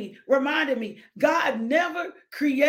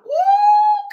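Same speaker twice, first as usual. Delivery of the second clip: high whooping cry of praise; ecstatic woo; 10 dB louder than usual. Real, not acted. Preacher whooping to the congregation, ecstatic high woo. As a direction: let out a joyful woo.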